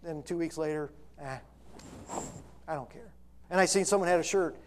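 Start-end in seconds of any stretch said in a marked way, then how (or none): 1.2-3.1 soft, low, offhand mock shrug; dismissive indifference. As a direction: toss it away quietly, imitating apathy. Acting out people's indifference afterwards.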